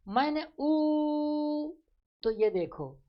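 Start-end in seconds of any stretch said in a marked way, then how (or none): 0.1-1.7 ऊ held very long, higher and louder. Shown as a demonstration. Teacher demonstrating vowel length, long loud ऊ. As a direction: stretch the ऊ out slowly. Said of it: "इस कारण से".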